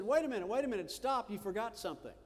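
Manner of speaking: high, loud call after a man walking away. Retold or imitated. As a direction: stop him before he's gone. Julio calls after the robber.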